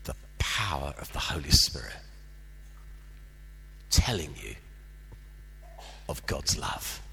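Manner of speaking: low, hushed, confiding whisper; a lightly voiced kind leader murmuring softly; gentle leader. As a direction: tell the boy gently, almost under your breath. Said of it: "and he said".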